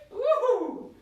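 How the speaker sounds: high, loud woo-hoo; excited whoop. Real, not acted. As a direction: let out a cheering woo-hoo. Coach cheering during the set.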